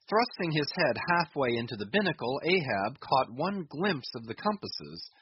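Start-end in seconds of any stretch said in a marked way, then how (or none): none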